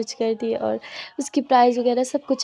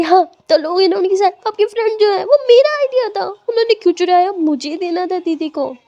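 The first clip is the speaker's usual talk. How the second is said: much higher and louder tearful wail; much higher, louder crying protest; upset wail; 7 semitones higher, 6 dB louder than usual. Acted. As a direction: protest through tears, slowing on the last line. Upset child protests to teacher.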